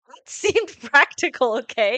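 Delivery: giggling defending her logic; laughing. Real, not acted. Laughing while defending herself to her friend.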